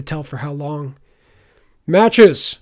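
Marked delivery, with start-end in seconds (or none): 1.9-2.6 high, loud call to a beast; shouted command. A narrator's reading. Pinned Wolf Rider summons the wolf, commanding shout. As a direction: yell the wolf's name. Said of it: "shouted the Wolf Rider".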